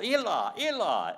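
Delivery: loud, high cry from the cross. Acted. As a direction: cry it out twice, high and strong.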